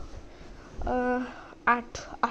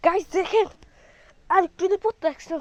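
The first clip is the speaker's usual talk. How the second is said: excited; 7 semitones higher, 7 dB louder than usual. Real, not acted. Excited after near fall.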